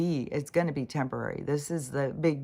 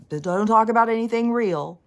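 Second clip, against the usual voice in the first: much higher, loud sarcastic jab; mocking outburst; 8 semitones higher, 10 dB louder than usual. Mocking gurus who forbid real talk, loud and high. Mocking guru's hidden rule.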